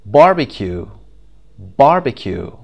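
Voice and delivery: "Barbecue" with a loud BAR; BAR-becue, strong first syllable, then dropping away; stress on first syllable. Shown as a demonstration. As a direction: punch the start of "Barbecue". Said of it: "The first syllable".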